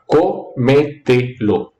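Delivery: "cómetelo" slowly broken into co, me, te, lo; syllable by syllable. Shown as a demonstration. Teacher showing students the four syllables of cómetelo, syllable by syllable. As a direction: count out each syllable of "cómetelo" with small gaps. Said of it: "co tiene cuatro".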